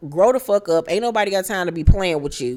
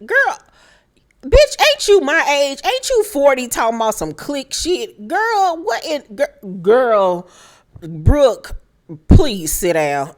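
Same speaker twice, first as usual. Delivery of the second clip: incredulous telling-off; 6 semitones higher than usual. Pretend. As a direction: scold her like a grown woman acting childish. Telling Brooke off directly.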